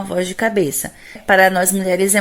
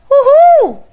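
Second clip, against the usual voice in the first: high, loud joyful whoop; celebration whoop; 9 dB louder than usual. Shown as a demonstration. Teacher demos a head voice whoop, high and loud. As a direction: whoop like you just won. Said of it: "Um na comemoração".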